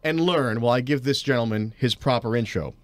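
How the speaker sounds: ordinary talk, nothing marked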